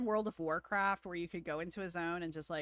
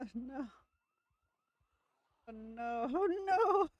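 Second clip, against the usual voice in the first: rising laughing shriek, much higher; laughing panic; 7 semitones higher, 6 dB louder than usual. Real, not acted. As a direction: squeal through laughter as it goes wrong. Caught by zombies, laughing.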